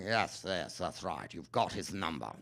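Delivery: lightly voiced German villain accent; hint of a hammy German accent; Jeremy Irons German accent. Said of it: "Jeremy Irons ... for going".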